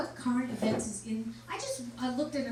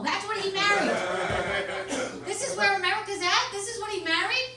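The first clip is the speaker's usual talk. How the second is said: mock outrage; 9 semitones higher, 8 dB louder than usual. Outraged rant about sex doll.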